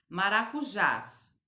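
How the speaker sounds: Maracujás slow and loud, stress on JÁS; stress on final syllable. Shown as a demonstration. Teacher shows pupils the strong syllable, Maracujás stressed on JÁS. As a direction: draw out the last syllable of Maracujás. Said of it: "a sílaba mais forte é o jás".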